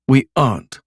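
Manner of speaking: firm falling answer; flat denial. Acted. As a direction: shut it down firmly. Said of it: "she said".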